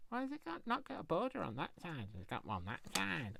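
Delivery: very high squeaky nitpicking voice; mock critic. Crafter imitates a picky viewer, high mock whine. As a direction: squeak out the fussy viewer's complaint. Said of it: "somebody will say".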